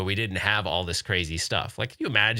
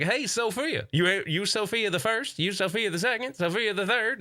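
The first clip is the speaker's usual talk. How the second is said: lightly voiced Donkey; 9 semitones higher than usual. Voicing Donkey teasing daughter.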